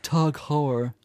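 put-on lightly haughty New England accent. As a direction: put on a snooty New England "Tag Heuer". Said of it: "that sort of haughty New England accent".

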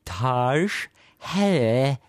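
"Taj Hair", like Taj Mahal plus hair; old mispronunciation. Shown as a demonstration. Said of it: "I used to pronounce it".

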